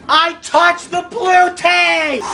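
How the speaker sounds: high, slow, stretched-out protest; indignant shout. Real, not acted. Player who touched the blue tape, indignant yelling. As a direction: yell each word out in disbelief.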